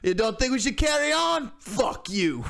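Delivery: shouty caricature of the band member; angry metal singer. Retold or imitated. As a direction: bark it at the interviewer like a furious rocker. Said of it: "And he's like".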